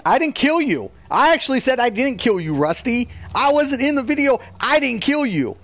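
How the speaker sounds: heated protest at Rusty. Real, not acted. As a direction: insist hard you didn't do it. Heated quarrel, insisting.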